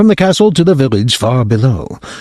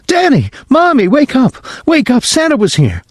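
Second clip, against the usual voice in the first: put-on very high, thrilled child's cry; excited little boy. Whipping boy waking his parents on Christmas morning, very high and excited. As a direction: wake them up bursting with joy. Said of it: "Whipping boy".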